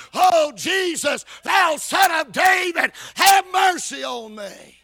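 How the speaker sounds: slow, loud shouted plea; drawn-out preacher's shout of a desperate cry. Acted. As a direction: holler it out for mercy. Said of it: "he began with a loud voice".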